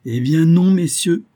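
high, loud refusal; emphatic contradiction. A narrator's reading. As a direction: cut them off with a ringing denial. Professor contradicts his listeners.

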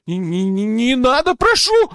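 stammered, climbing to a squeal; whimpering plea. A narrator's reading. Poacher begging his interrogator, rising whimper. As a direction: beg in rising, high-pitched panic. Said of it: "заскулил браконьер".

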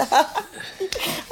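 squealing giggle, rising at the end; high ticklish giggle. Acted. Old lady giggles while her feet are handled. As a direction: let a high giggle escape.

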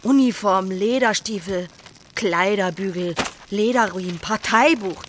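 shouted list, item by item; loud and defiant. Acted. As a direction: hurl each word into the fire. Burning old uniform, shouting.

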